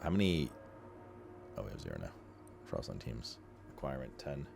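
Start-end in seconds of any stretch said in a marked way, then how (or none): none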